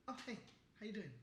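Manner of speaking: high, light little greeting; happy texting voice. Shown as a demonstration. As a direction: demonstrate a cheery inner reading voice. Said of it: "I'm just talking to myself".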